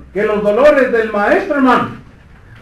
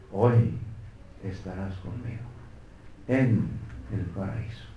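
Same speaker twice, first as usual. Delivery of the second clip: much softer, lower promise; tender hush; 13 semitones lower, 15 dB quieter than usual. Acted. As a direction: say it gently, almost a whisper. Preacher voices Jesus to thief.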